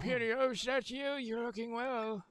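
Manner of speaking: put-on gentle old elder greeting. S0 voices the elder peony.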